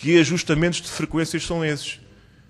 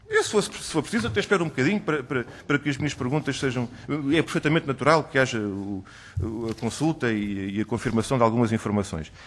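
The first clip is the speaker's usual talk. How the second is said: softer, lower, hesitant aside; quiet aside; 7 semitones lower, 9 dB quieter than usual. Real, not acted. Deputy offers to wait while the official checks information, quiet aside. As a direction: drop the voice for a halting, courteous aside.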